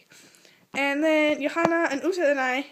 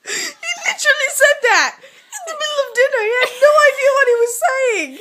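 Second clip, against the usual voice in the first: high, loud, laugh-choked talking; real laughing; 13 semitones higher, 8 dB louder, faster than usual. Real, not acted. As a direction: barely get it out through laughter. Cracking up at own story.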